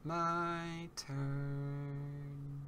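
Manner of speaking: teasing quiet sing-song. Pretend. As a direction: purr it like a scheming villain. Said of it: "repeated in another voice".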